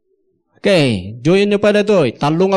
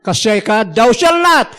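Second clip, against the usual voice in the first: shouted commandment; 3 semitones higher than usual. Retold or imitated. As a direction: bellow the rule like a scolding preacher. Mimicking legalistic preachers.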